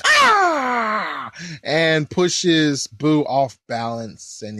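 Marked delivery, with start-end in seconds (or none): 0.1-0.8 high, sharp cry of effort; a quick strained shout while shoving; effort yell. Retold or imitated. Supreme Kai pushing Buu off balance, high effort cry. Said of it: "who just goes".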